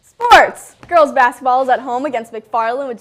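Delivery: big loud sports intro; announcer shout. Acted. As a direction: belt out the segment name.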